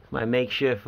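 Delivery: ordinary delivery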